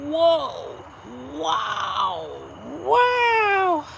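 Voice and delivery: high, loud whoa-wow gasps of awe; mock amazed onlookers. Imagined fans gasping at her body, hammed-up awe. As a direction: play the crowd gaping at her new body. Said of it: "Everybody's gonna be like".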